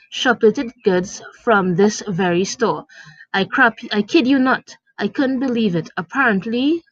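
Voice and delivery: ordinary delivery, nothing marked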